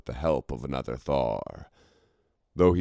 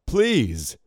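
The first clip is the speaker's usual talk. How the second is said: higher, louder desperate call; shouted plea; 9 semitones higher, 4 dB louder than usual. A narrator's reading. Alton pleading with the icons, shouting. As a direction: cry out to stop them. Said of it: "he shouted".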